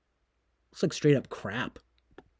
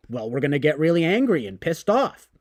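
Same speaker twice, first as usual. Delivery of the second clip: usual speaking voice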